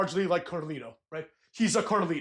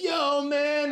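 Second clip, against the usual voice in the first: mock laid-back greeting pitched much higher; imitating Trick Williams; 8 semitones higher than usual. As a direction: toss off a breezy show-off hello. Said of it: "And then he was like".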